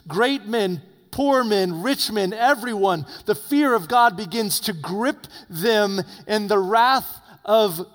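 loud, high fiery sermon voice; preacher rising to a fiery peak; preaching at full force. Acted. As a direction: thunder it out to the congregation.

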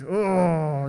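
drawn-out mock groan; long "oh" of mock dismay; exasperated groan. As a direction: groan like a kid caught without the answer. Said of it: "and then I groaned".

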